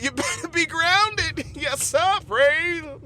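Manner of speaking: lightly hammed-up church exhortation; church exhorter. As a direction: urge the flock, pitch lifted. Plays church persona exhorting.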